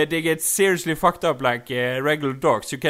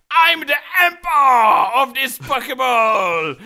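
high, loud, slow mock proclamation; grandiose Pikachu proclamation; 8 semitones higher, 7 dB louder, slower than usual. Pikachu declaring itself emperor, grandiose mock shout. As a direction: bellow it like a mad emperor, drawn out. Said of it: "another Pikachu is like".

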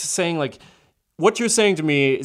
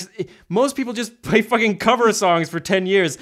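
ordinary talk, nothing marked

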